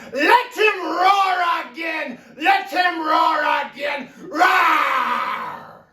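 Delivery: slow, drawn-out theatrical bellow; huge hammed-up shouted roar; roaring acclaim. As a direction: bellow it out grandly, ending in a lion's roar. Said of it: "I will make the duke say".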